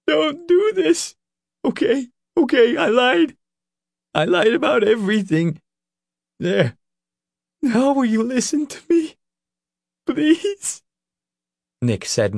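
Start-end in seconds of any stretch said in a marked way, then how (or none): 0.1-10.8 high, tearful begging; sobbing plea. Acted. Leroy confessing he lied so Nick will listen, sobbing. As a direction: plead with him through sobs. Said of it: "Leroy sobbed".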